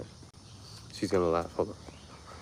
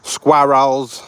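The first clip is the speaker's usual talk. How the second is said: put-on New York accent; 4 semitones higher, 6 dB louder than usual. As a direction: draw out "Squirrels" like an old New Yorker, exaggerated accent.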